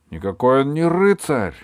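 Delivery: высокий громкий возмущённый протест; опровергни это с негодованием; возмущение. A narrator's reading. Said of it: "возмущался камердинер". (возмущённый крик)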